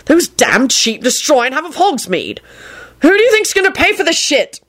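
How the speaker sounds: high-pitched outraged rant; angry shout. A narrator's reading. Boss raging at Neville about the bill, shouting. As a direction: push the pitch up into the question. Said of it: "he shouted at Neville".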